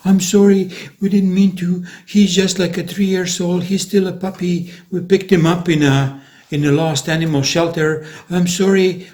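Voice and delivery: imitating a frightened stranger apologizing quickly; mock-frightened high apology; scared apology. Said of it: "Afraid that you cannot believe".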